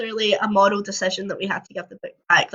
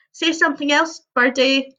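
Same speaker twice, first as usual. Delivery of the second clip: higher, gentle coaxing; 6 semitones higher than usual. A narrator's reading. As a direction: coax the bird sweetly. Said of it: "Johnny coaxes".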